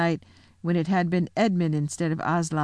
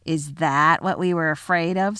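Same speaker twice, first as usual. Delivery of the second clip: lightly voiced mocking creature; jeering creature. A narrator's reading. Creature mocking Aslan without his mane. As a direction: sneer at the fallen lion. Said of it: "said another".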